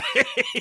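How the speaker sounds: loud squealing laugh; high burst of laughter. Real, not acted. Laughing at scene.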